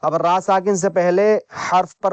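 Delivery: usual speaking voice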